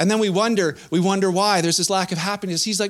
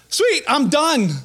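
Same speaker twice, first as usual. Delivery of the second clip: pleased relief; 7 semitones higher, much slower than usual. Acted. Acting pleased reader who finished.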